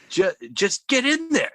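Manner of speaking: mock panic. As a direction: imitate a panicky woman pushing someone to hurry.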